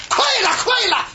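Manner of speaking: very high mock cheering on; urging on. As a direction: imitate someone insisting it is nearly there. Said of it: "快了半天也没快上来". (高声模仿催促“快了”)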